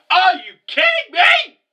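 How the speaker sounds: a real high-pitched yell of shock; shocked fan yelling at the screen; disbelieving shout. Real, not acted. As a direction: cry out, can't believe it. Stunned reaction to character's sacrifice.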